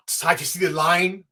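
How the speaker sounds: ordinary talk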